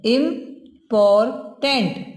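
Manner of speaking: slow "इम्पॉर्टेंट" in three separate beats; syllable by syllable. Shown as a demonstration. Teacher showing three syllables, "इम्पॉर्टेंट" said part by part. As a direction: break "इम्पॉर्टेंट" into "इम", "पॉर", "टेंट" with pauses. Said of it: "अब इसमें देखिए आप three syllable".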